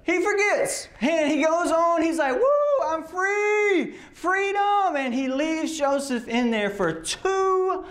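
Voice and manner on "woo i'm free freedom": high, giddy whoop of release; gleeful freedom shout. Acted. Cupbearer celebrating his release, high excited whoop. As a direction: crow with joy at being set free.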